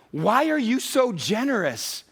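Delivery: high, slow, stretched-out question; wondering question. Retold or imitated. Someone asking a believer about their giving, wondering. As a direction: ask it high, marvelling and drawn out. Acted question from the story repeated.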